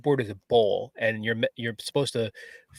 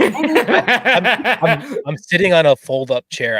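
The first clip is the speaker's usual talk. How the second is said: laughing; 7 semitones higher, 9 dB louder, much slower than usual. Real, not acted. Laughing about his bad chair.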